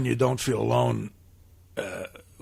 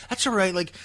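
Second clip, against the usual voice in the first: higher, gently patronising therapist voice; sensitive therapist; 7 semitones higher than usual. Retold or imitated. Therapist soothing an angry patient, higher mock-sensitive voice. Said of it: "a real sensitive woman".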